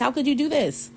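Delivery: very high-pitched mock astonishment; hammed-up, incredulous high voice; acted surprise. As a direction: squeal it in playful disbelief. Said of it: "These people are so surprised".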